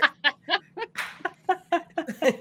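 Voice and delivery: high-pitched giggling laugh; high laughing fit. Real, not acted. Player cracking up as her bag is lifted, high laugh.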